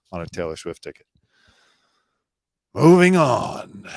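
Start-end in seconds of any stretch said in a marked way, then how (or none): 2.7-3.9 emphatic. Real, not acted. Host switches topic emphatically.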